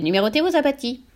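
husky, veiled parrot; muffled, raucous bird voice; veiled, hoarse voice. A narrator's reading. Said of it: "voilée et rauque cette fois-ci".

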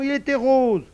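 high, loud correction; indignant little girl's protest. A narrator's reading. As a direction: correct them like an outraged child. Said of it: "dit Malika avec indignation".

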